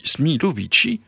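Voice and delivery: Smilovici rising high in delighted disbelief; surprised cry. A narrator's reading. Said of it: "s'était écrié Drioli".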